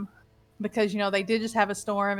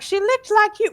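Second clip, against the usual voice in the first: high, loud accusing voice; Pearl; 11 semitones higher, 7 dB louder than usual. Retold or imitated. Player retelling what Pearl claimed, high insistent mimicry. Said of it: "she's like".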